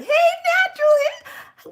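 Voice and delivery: very high, loud, bright call of hello; high sing-song greeting. Acted. Greeting a friend in a very high voice.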